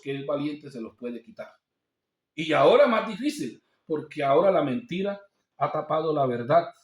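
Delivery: ordinary talk, nothing marked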